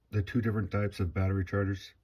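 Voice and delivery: normal talking voice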